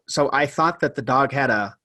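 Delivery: normal talking voice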